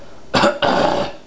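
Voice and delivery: rough rattling throat clear; throat-clearing noise. Shown as a demonstration. Coach showing the noise for false chords, throat-clearing rasp. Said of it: "a clearing your throat, like a".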